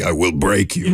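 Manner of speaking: put-on Rocky villain Russian accent; deadpan Russian accent threat. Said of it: "in that Russian accent from, uh, from Rocky".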